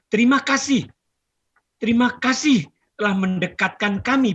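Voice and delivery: dramatic recital, phrases lifted and then falling away; stately, heartfelt poem reading; poetry recitation. Acted. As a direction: declaim a poem line by line with long pauses. Recites own poem to audience.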